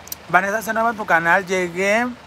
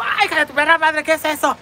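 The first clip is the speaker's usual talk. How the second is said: high, louder startled cry; imitating the friend yelling in disgust; outraged friend; 8 semitones higher, 4 dB louder than usual. Friend shrieking after the feather hits her nose, high and loud. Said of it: "Y dijo".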